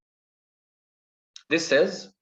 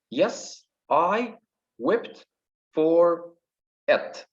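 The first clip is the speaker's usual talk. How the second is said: higher, one word at a time; syllable by syllable; 5 semitones higher, much slower than usual. Shown as a demonstration. Teacher counting five syllables, syllable by syllable. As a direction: count out "Yes, I wept for it" with pauses. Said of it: "Here we got five syllables".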